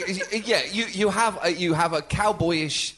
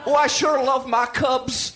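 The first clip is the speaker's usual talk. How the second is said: a hint of a cheery Chicago sports fan; light Chicago accent; 4 semitones higher than usual. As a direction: brag about the Cubs like a local. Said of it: "give me some Chicago".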